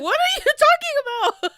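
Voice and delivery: very high, loud, incredulous squeal; laughing mock panic. Mary answering her murderous aunties, in laughing disbelief. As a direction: cry it out in mock alarm while cracking up.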